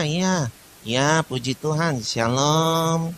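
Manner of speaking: usual speaking voice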